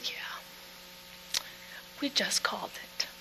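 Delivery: nurse's words in a voiceless whisper. Retold or imitated. Nurse quietly confirming the death to the wife, whispered. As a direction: let it out on breath alone, no voice.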